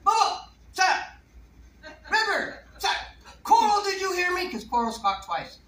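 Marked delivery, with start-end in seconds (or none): 0.0-4.5 high, loud parrot voice calling names; parrot yelling names. Retold or imitated. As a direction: bark the names like a scolding parrot. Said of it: "he's going".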